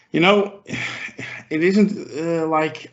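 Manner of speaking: ordinary talk, nothing marked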